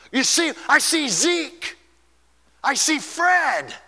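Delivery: high, slow, marvelling; a dying preacher seeing loved ones; awed, pitched high; dying man's wonder. Retold or imitated. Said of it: "began to say".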